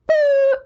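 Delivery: high, loud imitated phone boop; phone hang-up beep. Nurse hangs up on the wife, imitated phone boop. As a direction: make the sound of the call cutting off.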